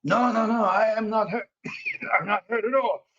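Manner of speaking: put-on high, excitable French Canadian accent. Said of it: "French Canadian, uh, voice".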